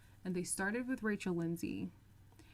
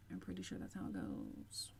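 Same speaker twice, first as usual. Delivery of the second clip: mumbled aside; 11 dB quieter than usual. Real, not acted. Trailing aside.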